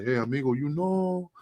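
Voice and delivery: mock Latin ref accent, pitch jumping high at the end; fake Latin accent. Puerto Rican ref mocking his old fake Latin accent. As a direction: ham up a put-on Latin accent for a laugh. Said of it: "I had to fake an accent".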